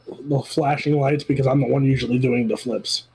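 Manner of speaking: normal talking voice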